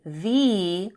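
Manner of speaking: THE said as a drawn-out "thee"; long ee vowel in THE. Shown as a demonstration. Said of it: "with a long sound".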